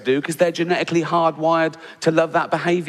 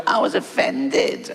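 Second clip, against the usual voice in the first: whiny offended blogger; 5 semitones higher, slower than usual. Retold or imitated.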